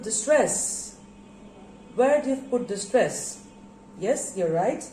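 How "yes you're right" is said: ordinary talk, nothing marked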